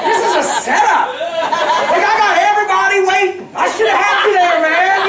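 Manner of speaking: high, loud outburst to the crowd; excited exclamation. Real, not acted. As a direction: shout it out with comic exuberance. Preacher exclaims to laughing crowd.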